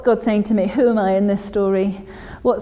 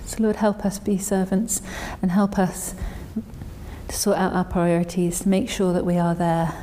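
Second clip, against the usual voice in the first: soft, hushed prayer with long silences; 2 semitones lower, 8 dB quieter than usual. Real, not acted. Preacher asks the Lord to make them good servants, quiet prayer. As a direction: pray quietly, leaving space after each petition. Closing prayer after the reading.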